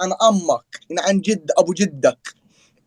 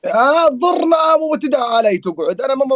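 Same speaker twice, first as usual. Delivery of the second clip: loud outburst; 7 semitones higher, 5 dB louder than usual. Real, not acted. Friend pleading against eating pepper.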